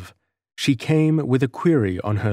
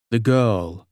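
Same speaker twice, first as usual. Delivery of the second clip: plain reading voice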